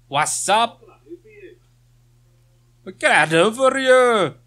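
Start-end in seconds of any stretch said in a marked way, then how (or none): 2.8-4.3 louder, higher put-on villager accent; playful imitation of the game's accent; mock NPC accent. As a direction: ham up the townsfolk accent. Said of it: "I can do that accent".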